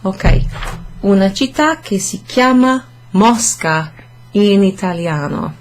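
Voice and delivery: usual speaking voice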